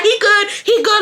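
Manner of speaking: mock a woman waving someone off; shooing her away. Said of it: "she was shooing her away".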